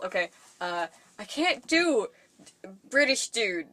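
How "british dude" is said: ordinary talk, nothing marked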